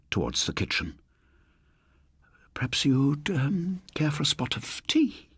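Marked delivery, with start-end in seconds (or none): 2.5-5.3 whispered, high, nervous offer of tea; hushed and frightened; a frightened spinster whispering; nervous old lady. Acted.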